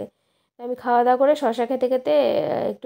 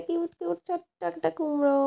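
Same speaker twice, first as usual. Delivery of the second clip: high, soft coo of delight; higher-pitched, sing-along cutesy gushing; 6 semitones higher, 4 dB quieter than usual. Real, not acted. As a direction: coo over something tiny and adorable. Gushing over a tiny pumpkin.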